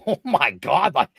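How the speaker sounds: reliving the shock of sharing a ring with a legend; starstruck disbelief. Retold or imitated. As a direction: blurt it like a fan meeting his idol. Starstruck in ring facing Matt Hardy.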